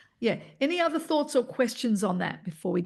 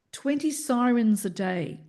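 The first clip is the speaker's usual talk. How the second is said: normal talking voice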